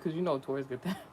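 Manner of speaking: ordinary delivery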